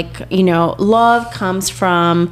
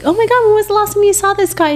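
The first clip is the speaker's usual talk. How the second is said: excited; 12 semitones higher, faster than usual. Retold or imitated. Excited quote to boyfriend.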